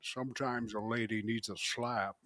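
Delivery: low, soft mock Scottish movie-star voice; impersonated Scottish actor, quiet and low; Sean Connery. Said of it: "doing a Sean Connery".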